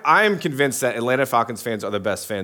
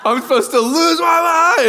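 put-on much higher, louder, laughing kid voice; ecstatic kid; 5 dB louder than usual. Son going wild over Falcons touchdown, ecstatic shout. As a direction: shriek it like a thrilled seven-year-old.

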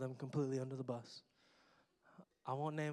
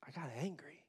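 hushed, breathy aside; whispered confession; 5 dB quieter than usual. Shown as a demonstration. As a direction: drop to a whisper to admit it.

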